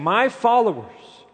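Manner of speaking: "My followers" high, loud and slow; emphatic. Acted. Preacher voicing Jesus to the congregation, emphatic. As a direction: push "My followers" up with slow weight. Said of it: "In other words, Jesus is saying".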